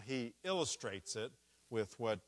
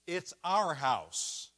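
"our" slow, high and loud; mock the wrong idea: "our" stretched and loud; stress on our; 6 semitones higher, 10 dB louder, much slower than usual. Shown as a demonstration. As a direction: drag out "it's our house" with the weight on "our". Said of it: "His emphasis is".

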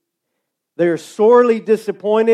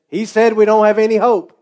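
loud, raised voice spelling it out; shouting to a deaf lady; 5 semitones higher, 6 dB louder than usual. Retold or imitated. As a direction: yell it so the deaf lady hears.

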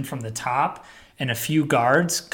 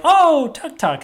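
much higher, louder "oh" of sudden understanding; alien realization; 14 semitones higher, 5 dB louder, slower than usual. Retold or imitated. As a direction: aliens suddenly get it, delighted. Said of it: "And they're like".

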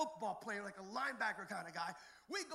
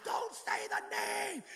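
high, slow drawn-out demon cry; a higher, slower, otherworldly yell; 11 semitones higher, 7 dB louder, much slower than usual. Retold or imitated. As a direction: stretch each word like a possessed girl screaming. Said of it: "she started yelling and saying".

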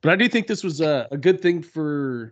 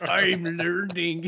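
drawn-out, very slow imitation; slow impression voice; 4 semitones higher, much slower than usual. Friend echoes the movie line to the others, slowly imitated. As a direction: stretch it out like a quoted movie line. Echoing the quoted Arnold line.

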